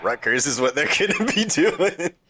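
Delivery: high, laughing tease. Real, not acted. Ribbing friends about fighting Rutgers, laughing. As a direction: break into laughter as you talk, pitch climbing.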